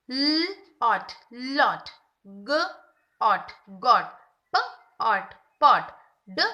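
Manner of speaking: slow, loud onset-and-rime blending; teacher reading word families to a child; phonics blending. Shown as a demonstration. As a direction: build each word from its sounds. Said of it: "ऑट words हम बच्चे को पढ़ाएंगे".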